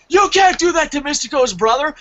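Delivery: high, indignant fan outburst; fanboy protest. Retold or imitated. Fan pleading for Mystico's brother, high-pitched protest. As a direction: protest as if your hero's family is threatened. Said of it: "I had a fanboy moment".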